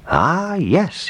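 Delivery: high, drawn-out pleased musing; pleased realisation. A narrator's reading. Baker hitting on the gingerbread man idea, pleased realisation. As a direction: let the idea dawn high in the voice.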